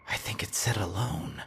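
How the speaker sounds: soft unvoiced whisper. A narrator's reading. Narrator whispering to Darren what the signal said, a whisper. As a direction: breathe the words out without voice. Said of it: "I whispered".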